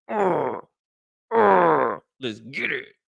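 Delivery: high, gruff pumped-up grunts; gym grunt. Retold or imitated. Hyping himself for the gym with mock grunts. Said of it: "I just wanna be like".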